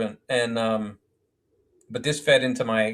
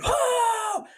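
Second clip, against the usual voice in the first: sudden very high shocked "Oh!"; whole room reacting, high and startled; class gasp. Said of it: "the whole class went".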